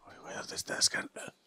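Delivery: breath-only whispered groaning; hushed whisper. Pretend. Player pricked while sneaking after an enemy, hushed whisper. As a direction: whisper it, trailing into little ows. Hushed search, hurting, in game.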